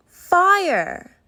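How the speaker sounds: loud, high alarm; dramatic cry. A narrator's reading. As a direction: announce the danger with alarm. Narrator's dramatic reveal.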